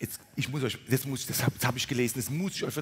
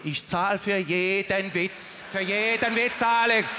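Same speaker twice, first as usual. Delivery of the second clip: drawn-out Swabian punter, building louder; insistent Swabian; 3 semitones higher, 3 dB louder, slower than usual. Acted. Swabian insisting he pays for every joke, insistent and rising. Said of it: "Und der Schwabe ist so".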